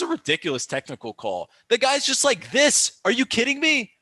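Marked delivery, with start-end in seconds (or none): 1.7-3.9 high, loud incredulous protest; outraged rant. Real, not acted. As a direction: protest the call as if it were absurd. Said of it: "Sorry, my little rant".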